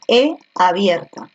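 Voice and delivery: slow, naming each vowel in turn; teacher breaking veía into vowels one by one; slow analysis. Shown as a demonstration. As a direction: step through E and I deliberately. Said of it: "Ahora voy a analizar uno por uno".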